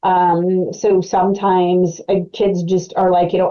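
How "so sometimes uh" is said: ordinary talk, nothing marked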